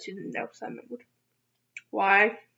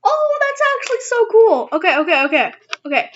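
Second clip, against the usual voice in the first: high, loud, thrilled burst; excited; 10 semitones higher, 7 dB louder than usual. Real, not acted. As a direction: gush with real delight. Excited at a package.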